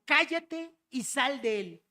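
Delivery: drawn-out commanding reprimand; stern rebuke. Acted. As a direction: rebuke the demon firmly, word by word. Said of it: "Jesús le reprendió diciendo".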